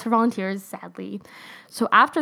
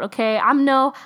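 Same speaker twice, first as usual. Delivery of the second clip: ordinary talk, nothing marked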